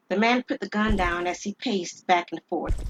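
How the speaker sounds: ordinary delivery, nothing marked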